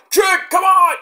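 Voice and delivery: high, loud shout of encouragement; encouraging shout. Retold or imitated. Melo on the apron urging Trick on, high shout. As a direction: yell him back into the fight from the apron. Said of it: "Starts like".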